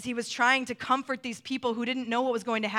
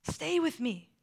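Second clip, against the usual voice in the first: pleading Jesus; 5 semitones higher, 6 dB louder, much slower than usual. Acted. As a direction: beg them to stay, then let it fall away. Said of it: "could be translated pretty directly as".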